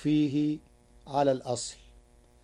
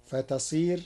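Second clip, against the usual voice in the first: normal talking voice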